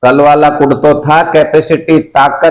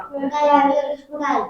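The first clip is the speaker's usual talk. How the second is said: class chorus; 13 semitones higher, 12 dB quieter, much slower than usual. Real, not acted.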